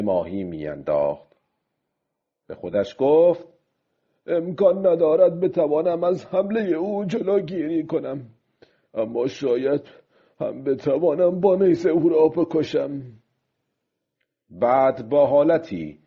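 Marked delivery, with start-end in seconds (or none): 4.3-13.0 higher, strained-pitch self-talk of the fisherman; old fisherman. Acted. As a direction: fisherman reasoning with himself, pitch raised. Old fisherman talks to himself.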